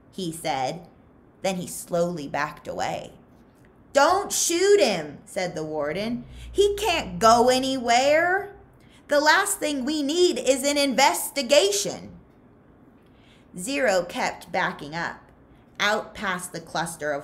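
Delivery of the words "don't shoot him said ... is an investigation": commanding warden. Acted. Warden ordering counselors not to shoot Zero, higher and louder. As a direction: halt the guards with cold authority.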